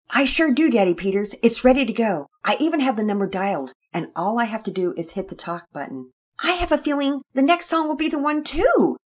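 put-on high, loud boy's voice, bursting with hope; a kid who can't sit still; high excited boy voice. Pearson's child voice, excited.

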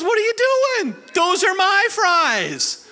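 high, drawn-out indignant husband voice; outraged husband. Retold or imitated. Husband protesting his fries are being eaten, high and stretched. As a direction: play the husband, shocked she's eating his fries. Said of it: "he looks at her and goes".

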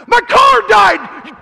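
slow, very loud, high yell; furious mock shout, high and loud; angry shout. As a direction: yell it through the window at the driver. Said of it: "I was just so mad".

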